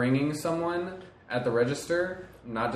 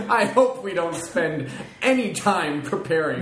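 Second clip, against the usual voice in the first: laughing; 6 semitones higher, 4 dB louder than usual. Real, not acted. Laughing at the unprepared show.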